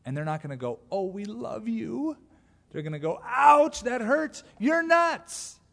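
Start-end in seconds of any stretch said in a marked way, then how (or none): 0.9-2.2 high, slow syrupy gush; slow, high mock adoration; mock sweet gushing. Imagined sweet response from the world. 3.2-5.6 high, loud yelp of protest; high-pitched hammed-up hurt outcry; mock pained outburst. World yelping at salt in wound.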